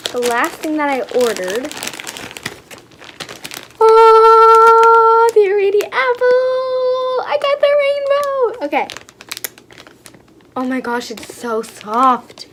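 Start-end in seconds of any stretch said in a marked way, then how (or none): 3.8-8.7 high, drawn-out squeal of delight; excited squeal. Real, not acted. Unboxer thrilled to get the rainbow, excited squeal. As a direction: sing out the thrill, loud and high.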